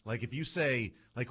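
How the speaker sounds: usual speaking voice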